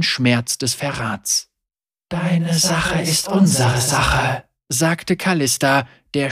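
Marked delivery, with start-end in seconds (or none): 2.1-4.4 slow, cold ghostly warrior; grave-cold spirit voice. Acted. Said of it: "Ihre Stimme war grabeskalt".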